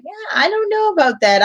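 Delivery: high-pitched, hedging doubt; doubtful reluctance. Retold or imitated. Teacher retelling her reaction to a summer offer, doubtful.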